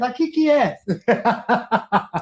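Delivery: stretched, amused mimicry; slow mocking repeat. Repeating the caller's threat slowly, amused and mocking. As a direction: drag out each word, laughing. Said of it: "slow mocking repeat".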